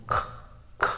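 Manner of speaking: খ said as a breathy kh; খ as aspirated k; demo breathy kh. Shown as a demonstration. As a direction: push air through খ with no voice. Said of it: "উচ্চারণটা এবার বলছি".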